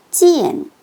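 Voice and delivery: 찌엔 falling steeply from high; fourth tone. Shown as a demonstration. Teacher demonstrates the tone, 찌엔 in falling fourth tone. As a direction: start 찌엔 high, drop to the bottom. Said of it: "사성으로".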